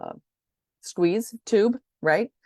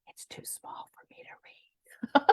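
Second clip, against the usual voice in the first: whisper; 8 dB quieter, slower than usual. Real, not acted. Squinting at tiny label text.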